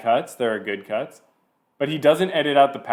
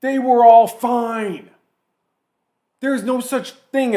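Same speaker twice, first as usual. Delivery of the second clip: hammed-up mock ranter; 9 semitones higher, 7 dB louder, slower than usual. Mocking the clip's war rant.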